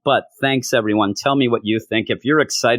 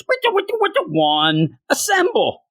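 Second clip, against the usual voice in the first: much higher, hammed-up DJ scratch and call; DJ hype. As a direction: spin it like a hype man. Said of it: "I might even go wicka wicka".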